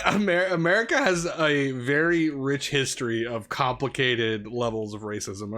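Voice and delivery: normal talking voice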